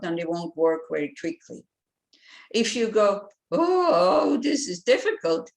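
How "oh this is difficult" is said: high, loud "Oh" dropping into "this is difficult"; dismayed whine. Shown as a demonstration. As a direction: sound defeated and blocked. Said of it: "it kind of blocks you".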